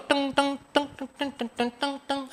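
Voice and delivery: soft sung-out tang tang of a guitar; guitar की नकल, धीमे स्वर में; imitated guitar strum. Shown as a demonstration. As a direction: strum a guitar with your voice, softly. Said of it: "ऐसे बजाता है ना".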